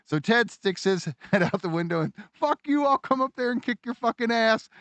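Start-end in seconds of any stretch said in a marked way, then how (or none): 2.3-4.7 mock angry yell. Ted yelling back at neighbour.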